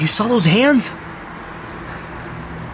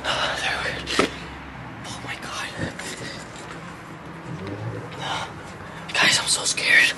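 hushed, frightened whisper throughout; scared whisper; 12 semitones lower, 11 dB quieter than usual. Acted. Friends sneaking through a haunted park, whispering in fear. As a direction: whisper so nothing out there hears you.

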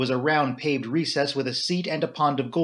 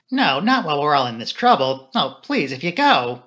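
louder, urgent entreaty; distressed plea; 5 dB louder than usual. A narrator's reading. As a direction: plead with him to stay. Flora pleads with him to stay.

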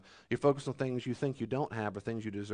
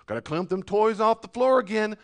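mock complainer; 8 semitones higher, 7 dB louder than usual. Voicing a complainer.

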